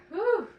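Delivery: loud high whew blown out hard; real whew from a coach out of breath; winded whew. Real, not acted. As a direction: release the effort in one big whew. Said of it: "I'm out of breath already".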